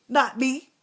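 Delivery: ordinary delivery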